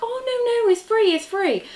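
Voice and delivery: high, excited mock friend voice; gushing friend. Friend insisting the yarn gift is free, high and excited. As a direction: squeal it's free like the generous friend. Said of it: "And she was like".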